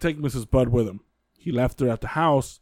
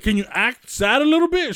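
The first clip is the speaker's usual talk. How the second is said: high reporter voice; 9 semitones higher, 4 dB louder than usual. Retold or imitated. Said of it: "he's he's like".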